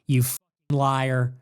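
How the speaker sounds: low slow seething contempt; contemptuous. Real, not acted. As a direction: drag it out with disgust. Insulting the quoted pundit.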